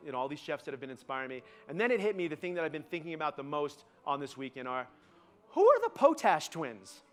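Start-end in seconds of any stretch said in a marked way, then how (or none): 5.5-7.0 high, slow comic outburst; exasperated bafflement. Acted. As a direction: burst out in mock-baffled disbelief. Comic bafflement after sincere build-up.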